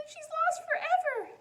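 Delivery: put-on very high, loud wail of a little girl; little girl panicking over her lost doll, high and loud; panicked child. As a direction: cry out that the doll is gone.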